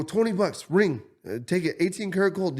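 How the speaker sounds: high, quick mock hawking; imitating a frantic seller. Stranger pushing his gold ring on the speaker, frantic mock hawking. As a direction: rush the sale pitch.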